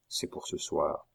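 low, quiet repeat; hushed echo. Acted. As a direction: echo it under the breath. Echoed confirmation, hushed.

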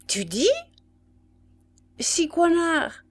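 puzzled query, the name slow and high; stupefied. A narrator's reading. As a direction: echo the name in disbelief. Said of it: "Ce fut le tour du sergent d'être stupéfait". (stupéfaction incrédule)

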